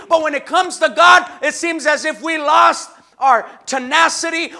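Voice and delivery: fiery sermon voice; preaching at full force. Acted. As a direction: drive it home to the church.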